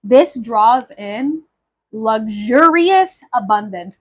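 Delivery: slow, weighty announcement; slow reveal. Acted. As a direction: draw it out for effect. Reveals herb meaning, slow.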